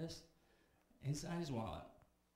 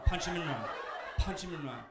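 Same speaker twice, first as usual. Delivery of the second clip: loud, laughing repeated command; frantic urging; 4 semitones higher, 9 dB louder than usual. Retold or imitated. Comic urging Darren, loud and laughing. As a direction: yell it through laughter.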